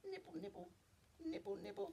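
tiny high, soft voice; little squeaky nibble sounds; mouse nibbling. Acted. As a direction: nibble quickly and softly like a mouse.

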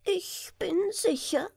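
a little chancellor's piping voice; high falsetto. Acted. Chancellor proposing marriage to the king, high falsetto.

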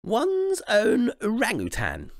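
high, slow, loud regal pronouncement; slow high queenly reveal; queen announcing. Acted. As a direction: declare it proudly as a royal wish. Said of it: "announced the queen".

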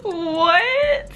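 high, loud "what?"; amused disbelief. Real, not acted. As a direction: blurt it high out of laughter. Shocked laugh at a reader comment.

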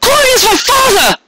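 high, tearful cry; voice breaking. Acted. Cupcake to Carrot, crying out, voice breaking. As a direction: call it out with the voice cracking. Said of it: "her voice breaking as the tears".

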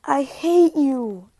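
slow, loud playful hate; playful mock hate. Real, not acted. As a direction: drag it out teasingly. After being caught.

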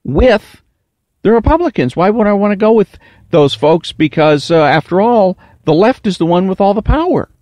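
put-on high naive teen voice; young kid voice. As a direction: sound like a puzzled youngster. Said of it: "a kid and I'm gonna think".

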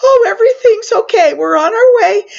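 relieved. Retold or imitated. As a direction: gush it out as the elevator moves again. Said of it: "I'm like".

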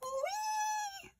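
long, very high, soft squeal; hen's shriek. A narrator's reading. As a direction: squeal like a hen shot skywards. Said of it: "shrieked the hen".

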